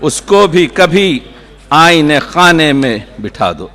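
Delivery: declaimed couplet, easing down to its close; poetry recitation. Acted. Poet repeating the couplet to a mushaira audience, declaiming. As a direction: recite with deliberate pauses between phrases.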